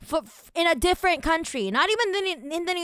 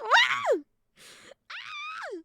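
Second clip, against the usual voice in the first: shrill outraged shriek. Real, not acted. Outraged cry at the document.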